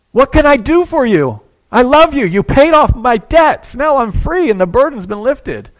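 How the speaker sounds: loud, high, overjoyed thank-you; grateful thanks. Pretend. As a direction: pour out thanks like a freed debtor. Imagined grateful debtor thanks benefactor.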